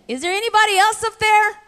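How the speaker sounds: high-pitched storybook shout for help; shouted call. A narrator's reading. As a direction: call upward in comic desperation, pitch jumping high. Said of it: "the man shouted again".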